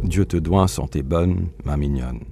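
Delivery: French verse read aloud with care; poem recitation. Acted. Translator quoting the poem's opening line to the host, recited. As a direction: lift the first words, then settle into the line.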